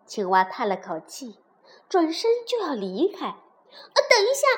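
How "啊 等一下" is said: high, loud, urgent cry; calling out. A narrator's reading. Princess stops departing frog, urgent shout. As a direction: call out to stop someone leaving. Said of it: "小公主喊道".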